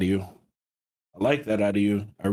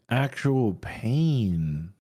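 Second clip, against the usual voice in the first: slow awed praise; very slow emphasis; much slower than usual. Real, not acted. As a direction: drag out "You put in actual pain" very slowly. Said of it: "put in pain".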